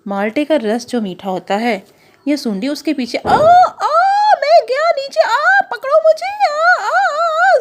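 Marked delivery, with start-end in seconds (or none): 3.2-7.6 high, loud far falling cry; falling scream. Acted. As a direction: scream as you drop down. Orange falling from tree.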